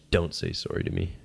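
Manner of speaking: low, quiet mock warning; retold stern rebuff. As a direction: drop it low and soft, imitating a cold warning. Retold stranger rebuffing an apology.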